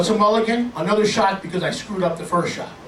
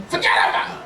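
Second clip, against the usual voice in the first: Italian-American dismissal; faster than usual. Acted. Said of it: "For your Italian family".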